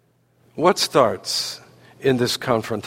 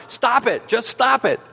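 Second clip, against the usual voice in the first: louder mock yell, pitched high; Bob Newhart; 9 semitones higher than usual. Counselor repeats himself to the flummoxed woman, high mock yell. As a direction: repeat the order higher, as a mock shout. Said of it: "he says it again, louder".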